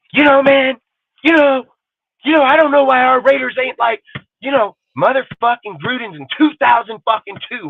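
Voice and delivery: high, loud mock voice of a clueless Raiders fan; squeaky mock football fan whining; mocking dumb fan.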